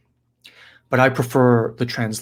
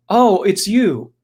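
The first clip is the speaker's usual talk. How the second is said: slow, higher, drawn-out realization; louder, stretched-out "oh"; acted recognition; 7 semitones higher, 5 dB louder, slower than usual. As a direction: greet an old rival with drawn-out surprise. Demon recognising his old friend.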